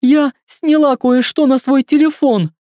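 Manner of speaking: sobbing. A narrator's reading. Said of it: "всхлипывает и вытирает слёзы".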